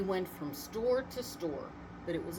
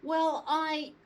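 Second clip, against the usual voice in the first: flustered; 9 semitones higher, 5 dB louder than usual. A narrator's reading. Santa flustered before judge.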